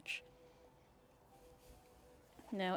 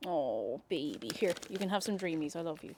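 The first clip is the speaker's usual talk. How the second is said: low, soft tender cooing; 4 semitones lower, 6 dB quieter than usual. Real, not acted. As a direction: murmur lovingly to the cat. Soft baby talk to cat.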